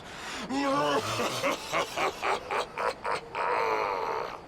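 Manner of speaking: low, mocking chuckle; villain's gloating laugh. Villain laughing at the trapped hero. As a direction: savour the coming victory.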